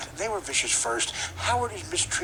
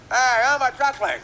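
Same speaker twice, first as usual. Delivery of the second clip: high, loud cartoon rooster bluster; hammed-up preacher-rooster voice; Sharpton Leghorn; 9 semitones higher, 7 dB louder than usual. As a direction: boom it out like a blustering cartoon bird. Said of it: "Sharpton Leghorn".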